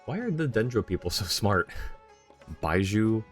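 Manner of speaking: ordinary talk, nothing marked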